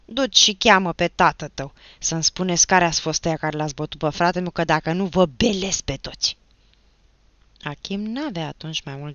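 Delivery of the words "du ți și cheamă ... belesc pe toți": loud, furious threat; heated, forceful warning; angry threatening. A narrator's reading. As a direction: threaten the whole gang at volume. Achim threatens the boys in fury.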